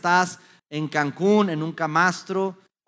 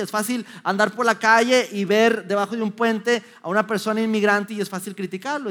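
normal talking voice

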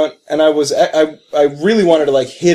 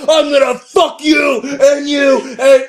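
high, slow over-the-top yell; a jokey madman rant, high and slow; mock hammed-up shout; 8 semitones higher, slower than usual. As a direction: ham up a crazed threat. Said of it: "you're like".

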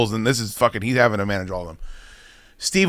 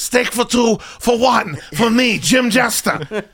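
higher, slow mock recital of the order; mocking high-pitched order; 8 semitones higher, slower than usual. Mocking Jester ordering the steak for two, high and slow. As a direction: recite it like a proud kid. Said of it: "He goes".

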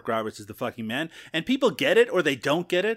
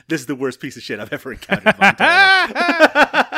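loud, higher-pitched outburst as the wife; oburzona żona; 6 semitones higher, 10 dB louder than usual. Retold or imitated. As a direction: blast out her verdict, hammed-up and half laughing. Voices his wife's scathing verdict.

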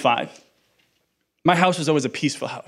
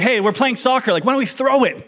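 higher, louder, dopey jock voice; mocking handball player; 9 semitones higher, 4 dB louder than usual. Pastor mocks the boyfriend's sport with a higher, louder dopey jock voice. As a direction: lampoon a clueless sports guy. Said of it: "it's like".

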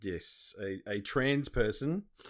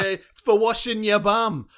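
loud, high, drawn-out mock holler; playful imitation of yelling to someone below; retold shout; 6 semitones higher, 8 dB louder, slower than usual. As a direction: holler it down from a window, stretching it out. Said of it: "he shouts to Sue".

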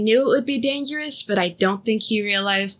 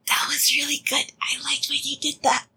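whispering; 5 semitones higher than usual. Real, not acted. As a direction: whisper an aside with delight. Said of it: "I know I shouldn't break the fiction".

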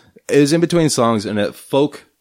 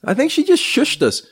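ordinary talk

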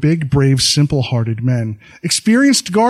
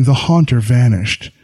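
ordinary delivery, nothing marked

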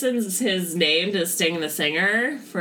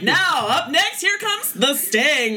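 higher, louder ring announcer call; old-timey wrestling announcer; 11 semitones higher, 4 dB louder, slower than usual. Retold or imitated. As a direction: boom it out like a vintage ring announcer. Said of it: "talk like an old-timey voices to announce wrestling".

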